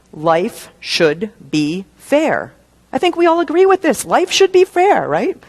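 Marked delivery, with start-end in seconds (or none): 2.9-5.1 high, fast, sing-song mockery of a naive belief; high-pitched mock sincerity. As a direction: play the wide-eyed innocent who thinks life owes them.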